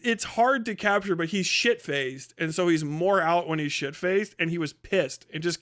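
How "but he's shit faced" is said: ordinary talk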